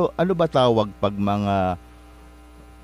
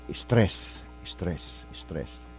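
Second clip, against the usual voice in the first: fading whisper; 5 semitones lower, 9 dB quieter, much slower than usual. Shown as a demonstration. Repeating stress, fading to whisper.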